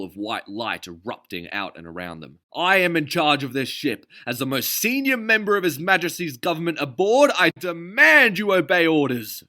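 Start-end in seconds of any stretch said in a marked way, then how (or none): 2.5-9.4 much higher, louder haughty bellow; pompous demand. A narrator's reading. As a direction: bellow it with wounded authority. Notley demanding obedience.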